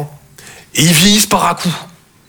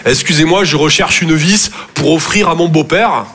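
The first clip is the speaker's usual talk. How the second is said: ordinary delivery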